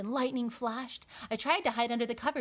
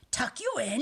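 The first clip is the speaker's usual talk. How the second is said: bellowing; 4 semitones higher, 4 dB louder than usual. Acted. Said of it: "he bellowed".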